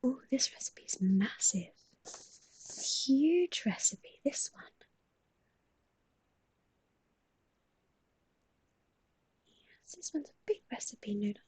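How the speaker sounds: hushed ASMR murmur. Real, not acted. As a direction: keep it a gentle whisper. Whispered ASMR reading.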